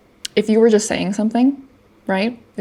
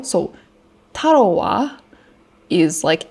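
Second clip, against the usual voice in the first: ordinary delivery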